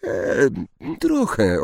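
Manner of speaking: hesitate, then answer trochę nervously; small unsure girl's voice; slightly trembling. A narrator's reading. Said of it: "lekko drżącym głosem".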